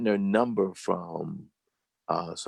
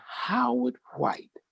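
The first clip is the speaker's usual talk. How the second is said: delighted recognition; 3 dB quieter, slower than usual. Retold or imitated. Teacher recognizes old student.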